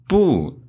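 不 falling sharply from high; original fourth tone. Shown as a demonstration. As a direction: start 不 high and drop it. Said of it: "its original tone is fourth tone".

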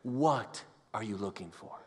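soft searching question. Real, not acted. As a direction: ask it like a whisper to the heart. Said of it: "is asking us the same question today".

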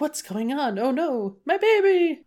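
rising to a high, alarmed cry; panicked parents. Retold or imitated. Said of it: "they're like".

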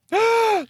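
sharp high gasp; shocked gasp. Real, not acted. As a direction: gasp in mock outrage. Shocked reaction to story.

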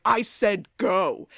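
slow, forceful order; retold vampire outburst; vampire's command. Acts out vampire snapping an order.

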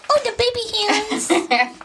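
high teasing coo. Real, not acted. Teasing about small hands.

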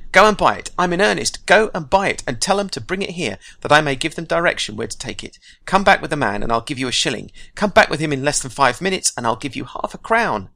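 put-on high and loud, urging the boy on; eager Scrooge. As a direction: rush him off with glee.

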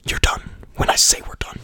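unvoiced menacing whisper; whispered threat. Host jokes a villain's threat, whispered. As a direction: breathe it like a movie villain.

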